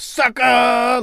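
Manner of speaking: high, sharp mock yell; yelled taunt. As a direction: bark out the taunt. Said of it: "he yelled".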